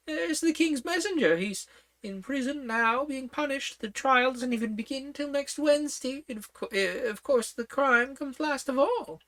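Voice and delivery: put-on high, fussy old queen. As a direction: talk up high like a flustered queen.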